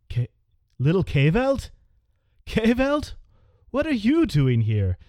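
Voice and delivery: put-on an old man who can't believe his ears; astonished recognition. Blind old sailor recognizes young Kaveld, high and amazed.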